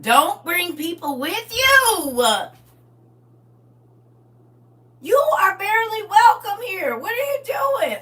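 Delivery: high, loud, slow indignant shout; a hostess yelling at uninvited parents; mock outrage. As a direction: tell them off at full volume. Telling off guests' parents.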